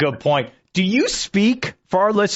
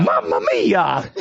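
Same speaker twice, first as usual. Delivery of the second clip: slow, drawn-out imitation in a lower natural register; amateur Mario; 3 semitones lower, slower than usual. Host echoing the voice actor, amateur Mario. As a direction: copy the cartoon plumber, slow and low.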